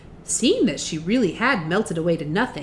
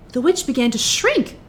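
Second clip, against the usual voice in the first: ordinary delivery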